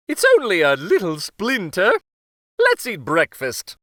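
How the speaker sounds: change the subject as the king; king downplaying it. King to the queen, breezy and dismissive. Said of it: "said King Kenric".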